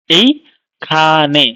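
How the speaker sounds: এইখানে broken into syllables with gaps, এই, খা, নে; syllable split. Shown as a demonstration. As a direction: draw out এইখানে slowly, syllable by syllable. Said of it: "syllable যেরকম আমরা ভাগ করি".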